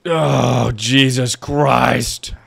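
loud, high, drawn-out exclamation; exasperated outburst. Real, not acted. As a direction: cry out at a near disaster. Startled frustration during hard game.

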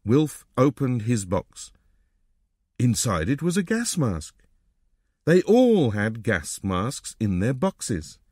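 ordinary delivery, nothing marked

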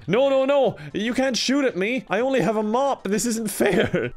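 high, loud panicky pleading, slowing at the end; begging the game not to shoot; panicked protest. Real, not acted. Player pleading with guards shooting at her, high panicked protest.

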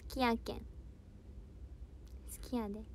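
light mock Kansai dialect; softly tried Kansai dialect; playful dialect. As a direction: try out Kansai dialect softly, a little shy. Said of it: "関西弁はマスターしました".

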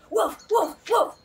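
three loud woofs; barking. Acted. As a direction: bark back hard at the parrot. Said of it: "Einstein barked".

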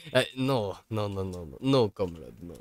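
ordinary talk, nothing marked

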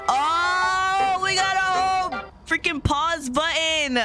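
high, drawn-out excited cry; excited discovery. Real, not acted. Player discovering a pause button, excited outburst. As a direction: call it out slowly, amazed.

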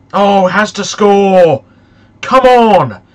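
real pleading yell at the game; urgent pleading shout. Real, not acted. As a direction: urge the striker on, loud. Urging his striker to score.

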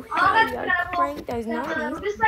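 loud, hyped shout to the chat; hyped call-out. Real, not acted. Streamer shouting at his chat to crank nineties, hyped. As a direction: fire up the viewers.

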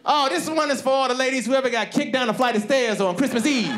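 high, impassioned song dedication; hammed-up singer working the audience. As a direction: dedicate it fervently to the crowd. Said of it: "She's like".

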